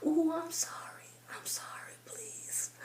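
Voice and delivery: hushed whisper, pleading softly; whispered apology. Pretend. Whispering sorry to her hair as the iron sizzles. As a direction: whisper sorry to your hair like it can hear.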